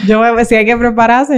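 real amused laughter between words; laughing. Real, not acted. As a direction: laugh it off while answering. Laughs at being called out.

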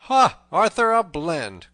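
high, loud exclamation; exclaimed recognition. A narrator's reading. Robin recognizes the tanner's name, loud exclamation.

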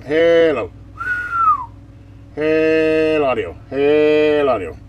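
slow, loud, stretched-out hello audio; slow mic test call. Real, not acted. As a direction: drag each word out loud to show the waveform. Drawn-out mic test calls.

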